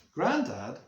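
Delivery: ordinary delivery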